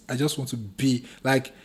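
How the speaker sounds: usual speaking voice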